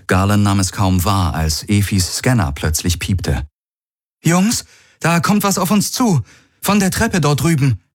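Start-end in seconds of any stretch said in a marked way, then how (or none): 4.2-7.8 high, urgent alert; alarmed warning. A narrator's reading. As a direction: warn the others, pitched way up. Scanner beeps, warning of approaching threat. (alarmierte Warnung, hoch und dringlich)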